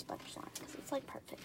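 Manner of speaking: hushed unvoiced aside; whispering. Real, not acted. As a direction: drop to a whisper. Soft comment.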